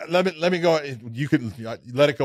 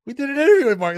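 much higher, louder insistence; excited; 13 semitones higher, 8 dB louder, much faster than usual. Real, not acted. Host urging the crew to stop the clip, excited and loud. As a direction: cut in eagerly to get it heard.

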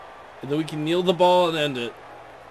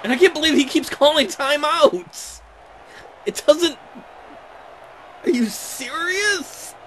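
exasperated disbelief; 8 semitones higher than usual. Real, not acted. Coach calls pointless timeouts.

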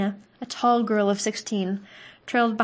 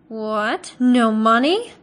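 sharp, louder disbelief; sharp cut-off; 7 dB louder, much slower than usual. A narrator's reading. Butcher interrupting the penniless hunter, sharply. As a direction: cut him off with it. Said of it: "cut him off sharply".

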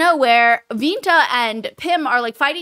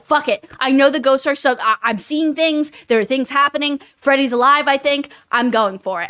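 louder, higher fired-up quote; determined resolve; 4 semitones higher, 5 dB louder than usual. Retold or imitated. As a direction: charge ahead, all in. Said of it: "she's like".

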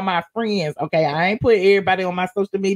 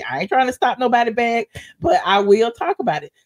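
ordinary talk, nothing marked

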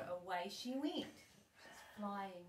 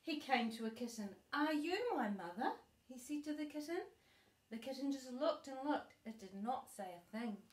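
gentle storybook reading voice; reading to a child; 4 semitones higher, 5 dB louder than usual. Acted. Grandma reading a bedtime book to her granddaughter, gentle storybook voice.

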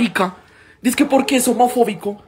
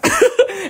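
carcajada. Real, not acted. Laughs at own joke.

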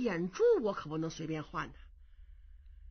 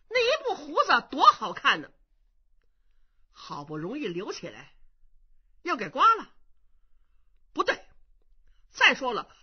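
angry accusation; 3 semitones higher, 5 dB louder than usual. Acted. Young monk exposing the impostor's accent, furious. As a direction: pile up the proof and explode. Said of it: "冲着孔秀一瞪眼".

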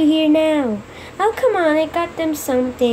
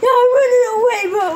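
high, raised-voice protest; acted panicky refusal; 8 semitones higher than usual. Character fleeing the others, high protesting yell. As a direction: yell your refusal as you flee.

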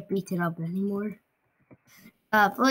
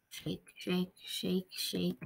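whispering; 4 semitones lower, 11 dB quieter, much slower than usual. Real, not acted. Sneaking again, very quiet.

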